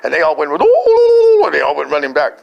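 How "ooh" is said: loud, very high "ooh"; long whooping wail of scattering kids; comic group shriek; imitated fleeing squeal.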